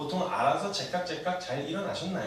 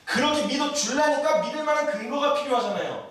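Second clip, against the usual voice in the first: high-pitched, insistent sermon delivery; impassioned preaching; 11 semitones higher, 6 dB louder than usual. Real, not acted. Preacher urging the congregation to simply trust God, impassioned. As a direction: drive the point home to the congregation.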